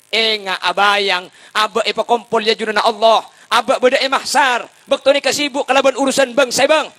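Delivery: high, loud sermon delivery; full-force preaching. Acted. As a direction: drive each phrase home to the congregation.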